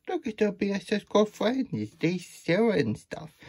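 gushing. Real, not acted. Gushing over friendship.

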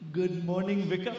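put-on Pakistani accent; little boy's line in a mock Pakistani accent. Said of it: "you're talking with a Pakistani accent".

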